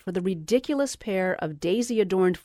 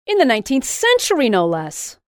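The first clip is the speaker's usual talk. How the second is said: high, loud, fast excited exclaim; excited shouting; 5 semitones higher, 7 dB louder than usual. A narrator's reading. Louise on the phone shouting to Jessica, high and loud. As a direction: burst out with giddy excitement. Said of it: "Louise was shouting".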